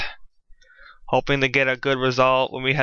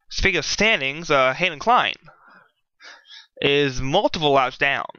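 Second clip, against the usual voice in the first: normal talking voice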